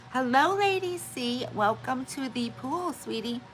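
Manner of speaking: high-pitched sing-out greeting, opening slow and loud; high, effusive hello; gushing welcome. Real, not acted. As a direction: welcome a newcomer in a high, drawn-out call. Greets a viewer joining the stream.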